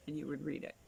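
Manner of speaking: usual speaking voice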